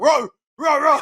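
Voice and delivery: very high laughing mock roar; imitated roaring. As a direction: roar through laughter like a crowd of fans. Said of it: "the mom and everyone was like".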